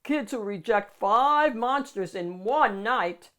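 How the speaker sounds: loud monster warning. Acted. Monster warns the child not to send him away. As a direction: warn them, half-menacing.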